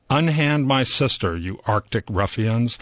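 usual speaking voice

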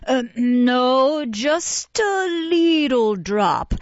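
high, slow wheedling coax; persuasive. A narrator's reading. Nurse persuading the beadle to take gin, slow and high. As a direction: draw out the words to tempt him. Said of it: "said Missus Mann persuasively".

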